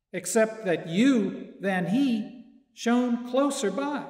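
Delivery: ordinary talk, nothing marked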